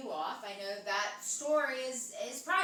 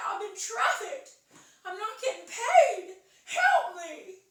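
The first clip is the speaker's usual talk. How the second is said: high, loud put-on girl voice; hammed-up girlish plea for rescue; little girl's complaint; 8 semitones higher, 8 dB louder, slower than usual. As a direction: protest loudly as the trapped label girl. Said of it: "And she says".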